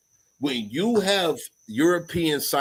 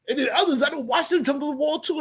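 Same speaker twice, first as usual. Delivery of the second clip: ordinary talk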